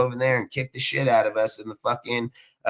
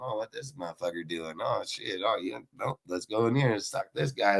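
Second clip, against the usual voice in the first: muttering coach; 2 semitones lower, 7 dB quieter than usual. Retold or imitated. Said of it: "You walk by your room".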